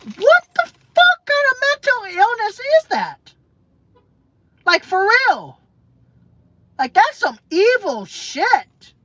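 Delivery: high, loud disbelief; incredulous outrage. Real, not acted. Vlogger ranting to viewers, high and incredulous. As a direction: shriek it in disbelief.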